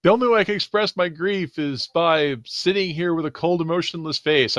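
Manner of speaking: earnest, hurt bug creature; forlorn bug alien. Acted. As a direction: explain your grief in a high little voice. Plays a grieving alien bug.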